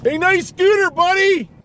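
loud friendly holler at a passerby; calling out. Real, not acted. Calling out from the car to a man on a scooter, loud holler. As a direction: shout across the street, cheerful.

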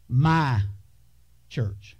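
my hit loud, church dropped low; stress on my. Shown as a demonstration. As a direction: punch the word my, let church fall away. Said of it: "The word "my" is more important".

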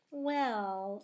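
ordinary delivery, nothing marked

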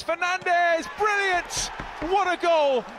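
high, loud burst of joy; goal roar. Real, not acted. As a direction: erupt as the ball hits the net. Counter-attack goal called live.

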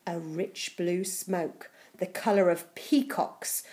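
ordinary delivery, nothing marked

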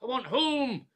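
high, pleading cries to go home; upset shouting. Acted. Confused old man shouting at his carer to go home. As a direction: wail to be taken home. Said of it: "Please don't shout".

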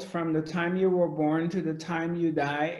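ordinary delivery

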